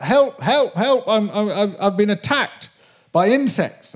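high, loud desperate calls for help; high frantic retold cry for help; shouting for help. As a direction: call up the ladder in a panic.

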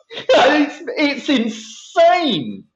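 laughing high and slow through it; real laughter, words stretched; laughing disbelief. Real, not acted.

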